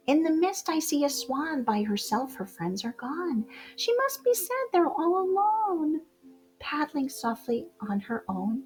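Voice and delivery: ordinary delivery, nothing marked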